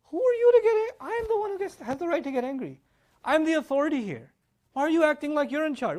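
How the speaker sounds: high-pitched, outraged scolding; indignant parent. Acted. Parent to little one who joined in, high indignant scolding. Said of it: "If I'm yelling at one of my kids".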